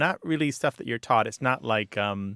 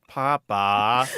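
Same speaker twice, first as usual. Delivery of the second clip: imitating a child's exasperated "Papa"; loud, put-on complaint from a kid; protesting son; 5 dB louder than usual. Son objects to parent's joking overreaction, mock exasperation. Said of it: "he'll be like".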